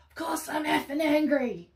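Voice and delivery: high, loud furious yell; angry yell. Retold or imitated. Angry father yelling at the social worker. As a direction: yell it back at her, enraged. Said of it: "And he would go".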